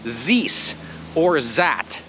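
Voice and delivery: "zis or zat" with buzzing z, slowed; mock z for th. Shown as a demonstration. Teacher mocking the worst learner mistake, slowed. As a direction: say "zis" and "zat" like a heavy accent. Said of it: "what's even worse".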